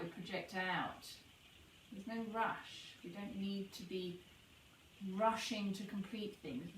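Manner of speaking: soft, unhurried meditation voice with long pauses; guided meditation. Acted. Guide leading listeners to notice the breath pause, soft meditation voice. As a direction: ease them into the breath, gently.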